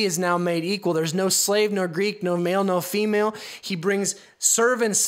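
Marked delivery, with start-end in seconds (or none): none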